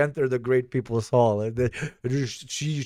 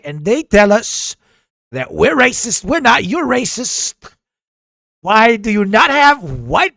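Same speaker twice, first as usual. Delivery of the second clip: higher, emphatic mock-politician harangue; indignant delegation spokesman; 11 semitones higher, 5 dB louder than usual. Delegation confronting President Xi about his team, mock-indignant politician voice. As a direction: challenge them with comic indignation, punching key words. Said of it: "They're like".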